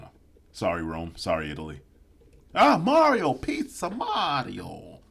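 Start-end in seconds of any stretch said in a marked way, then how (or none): 2.5-5.0 high, loud hammed-up Italian accent; mock Italian. Mocking Rome with a cartoon Italian accent.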